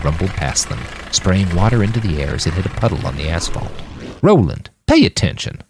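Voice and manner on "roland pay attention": higher-pitched, sharp call to attention; sharp, impatient reprimand; snapping. A narrator's reading. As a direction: snap someone out of a daydream. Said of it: "Jim's voice snapped him back to reality".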